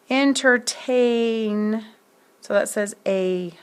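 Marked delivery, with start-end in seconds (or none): 0.1-1.8 Entertain drawn out very slowly, the ai in TAIN stretched; slow sounding out. Shown as a demonstration. Teacher stretching a word to show its vowel team. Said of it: "the A I".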